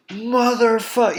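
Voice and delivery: high, drawn-out furious curse; mock rage. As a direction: curse as if mid-punch, hammed-up. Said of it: "so angry".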